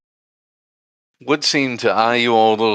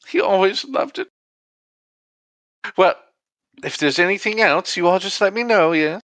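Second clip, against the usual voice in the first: high, airy lady's voice; gracious hostess; 10 semitones higher than usual. Acted. Game master as the woman recalling her husband's favorite, high affected voice. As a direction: chat fondly in a high put-on voice.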